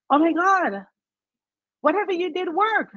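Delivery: the doctor can't believe the scan; amazed doctor. Retold or imitated.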